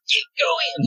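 put-on a high, yelled "Keep going!" as Sasha urging the kid on; sudden high shout to make the child run; mock yell. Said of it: "she's supposed to have a southern accent".

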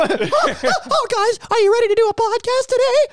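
very high squeaky cartoon mouse voice; Mickey Mouse voice. Producer as Mickey Mouse greeting the hosts, squeaky. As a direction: chirp it like a cheery cartoon mouse. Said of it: "she's like Mickey Mouse".

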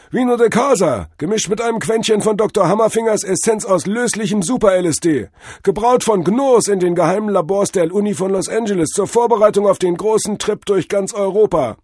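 high, loud pitch for a secret brew; jive-talking drug-vision god. A narrator's reading. God tells Cordell what the wine was, high guru. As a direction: reel off the recipe like a proud trippy guru. Figure of Tod Hermes.

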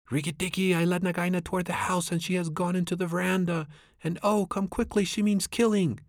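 very high, frantic bird voice calling for help; panicked screaming. Acted. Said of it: "Darzee's wife screaming".

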